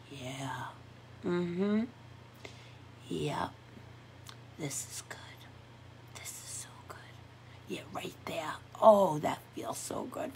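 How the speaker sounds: whispered, melting murmurs of pleasure; blissed-out murmur. Retold or imitated. Imitating herself whispering bliss during a massage. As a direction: murmur it like you're lost in a massage. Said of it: "and you're like".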